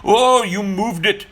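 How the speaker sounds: high, loud, slow accusation in a mock Indian accent. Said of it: "And he goes".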